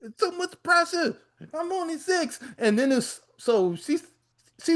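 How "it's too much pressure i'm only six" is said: put-on crying little boy. Six-year-old pleading with his grandma, high and whiny. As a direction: wail it like a small kid who can't take more. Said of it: "He's like".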